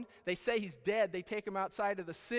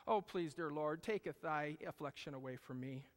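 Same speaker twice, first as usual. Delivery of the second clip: soft, lower, overly pious plea; a hammed-up prim prayer, softer; mock pious prayer; 6 semitones lower, 6 dB quieter than usual. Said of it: "I don't think he prayed like that".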